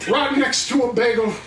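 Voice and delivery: a bad drama-student Southern accent; overdone Southern accent where it makes no sense; mock Southern accent. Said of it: "They'd even use it in things that didn't need it".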